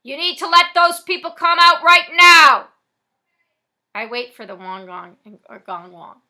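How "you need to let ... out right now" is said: loud demand. Acted. Voice from outside demanding.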